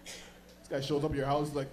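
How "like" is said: ordinary delivery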